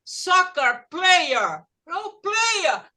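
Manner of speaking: imitating an insistent foreigner, slow and stretched; retold foreign speaker. Foreigner explains to the teacher who they mean, imitated insistence. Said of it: "a pessoa falou".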